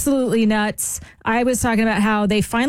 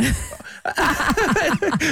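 hearty laugh; 5 semitones higher than usual. Real, not acted. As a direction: burst out laughing. Laughs at co-host's joke.

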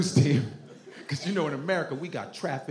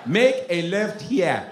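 put-on slow, raised Nigerian accent; African GPS; 4 semitones higher, slower than usual. African GPS directing a driver, slow Nigerian accent. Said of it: "I have African G P S".

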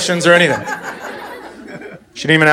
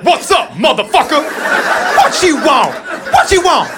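higher, hammed-up tough-guy bark; street tough voice; 10 semitones higher, slower than usual. Comic imitates a rude phone answer, higher mock gangster. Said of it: "she was like".

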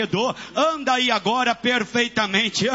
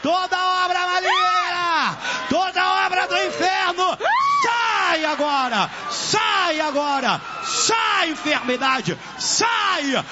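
high, drawn-out shouted commands; full-force preaching; 8 semitones higher than usual. Real, not acted. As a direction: drive out evil at the top of the voice.

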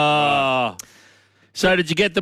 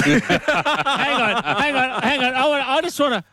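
higher, laughing hang on; laughing interruption; 8 semitones higher than usual. Real, not acted. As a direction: cut in while laughing.

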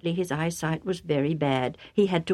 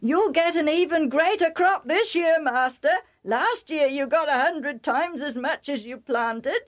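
high, eager promise to the master; excited servant; 10 semitones higher, 3 dB louder than usual. Acted. Joktan promising Isaac a greater crop, excited. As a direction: gush the good news, pitched high.

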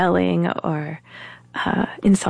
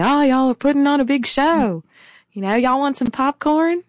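high-pitched mock of a taunting neighbor; imitated mocking; 7 semitones higher than usual. Neighbor taunting the family while watching with popcorn, mocking. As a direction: lift the voice into a jeering Southern-style "y'all" taunt. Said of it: "mocking us and saying".

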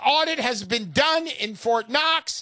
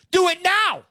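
high, loud urgent command; urgent push; 10 semitones higher, 6 dB louder than usual. Real, not acted. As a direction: push them hard to act right now. Urging listeners to buy.

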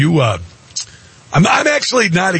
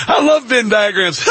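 higher, gushing mock quote; mocking Harris; 7 semitones higher than usual. Host mimicking Harris, higher gushing voice. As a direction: gush like she adores diagrams. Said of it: "She's like".

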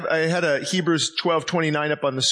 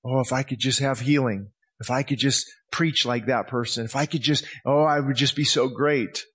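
mock selfish prayer; 5 semitones lower than usual. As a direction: sigh it out like a self-pitying believer. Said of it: "that can't be a selfish prayer".

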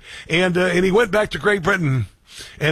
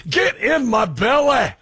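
slow, much higher mock Scottish accent; Scottish movie catchphrase, mock accent; Fat Bastard Scottish; 7 semitones higher, slower than usual. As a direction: ham up a Scottish movie glutton line. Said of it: "everybody started doing a Scottish accent when Fat Bastard came along".

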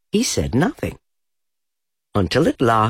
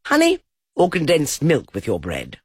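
rabbit voice; 6 semitones higher than usual. A narrator's reading.

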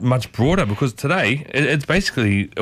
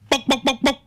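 high mock chicken clucking, bok bok; chicken clucks; 12 semitones higher than usual. Radio host clucking like a chicken before the phrase. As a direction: cluck like a hen to tease the topic.

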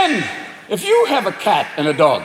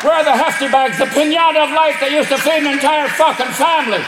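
angry Irish raccoon; 6 semitones higher than usual. Acted. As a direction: rage like an outraged Irish raccoon. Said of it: "I made the raccoons Irish".

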